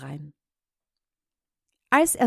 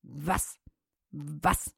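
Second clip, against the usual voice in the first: soft low barked Was; dog bark; 4 semitones lower, 8 dB quieter than usual. Acted. The dog answering with a low soft bark. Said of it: "antwortete nichts als".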